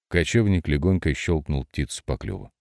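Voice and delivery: ordinary delivery, nothing marked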